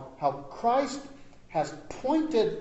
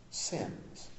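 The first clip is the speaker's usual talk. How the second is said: whisper; 5 semitones lower, 11 dB quieter than usual. Real, not acted. Preacher drops to a hush.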